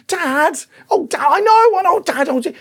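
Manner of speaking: high, loud whiny protest; mock-exasperated "I know", voice way up; exasperated son. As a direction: imitate a kid groaning at a parent's affection. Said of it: "like the way my son says it".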